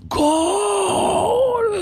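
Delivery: a long, high, stretched-out roar of goal; crowd shouting goal. Retold or imitated. A stadium crowd cheers a goal, a long high roar. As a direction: belt it out like ten thousand fans at once.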